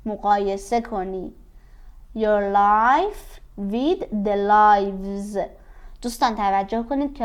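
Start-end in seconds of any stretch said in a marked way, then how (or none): 2.2-5.4 your life with the lives, each word drawn out slowly; slow classroom dictation. Shown as a demonstration. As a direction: stretch every word for the class. Teacher spells out phrase slowly.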